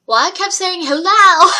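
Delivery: high, loud, hammed-up comeback; indignant retort. Worker arguing back at the caller about the phone, indignant. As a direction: fire it back, blasting the hello in mock outrage.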